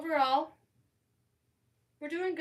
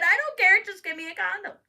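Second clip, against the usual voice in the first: high, loud impatient demand; exasperated shout; 9 semitones higher, 12 dB louder than usual. Retold or imitated. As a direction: bark it at your son, fed up. Frantic demand to son.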